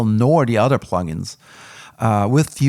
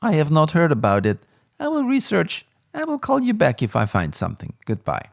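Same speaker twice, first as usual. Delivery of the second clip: higher, mock Eastern European accent, curt; lightly imitated Bulgarian helpdesk guy, shrugging it off; blunt foreign support agent; 9 semitones higher than usual. Reviewer imitating the Bulgarian support guy, a mock curt accent. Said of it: "They are like".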